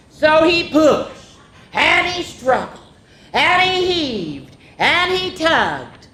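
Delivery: high, slow, drawn-out straining; straining effort. A narrator's reading. Narrating the squirrel heaving at the apple, slow and strained. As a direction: stretch each verb like heaving hard.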